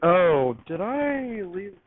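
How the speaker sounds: normal talking voice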